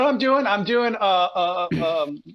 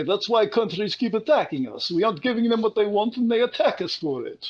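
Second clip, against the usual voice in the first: hammed-up Israeli accent. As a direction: put on a rough attempt at an Israeli accent. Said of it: "says in his Israeli accent".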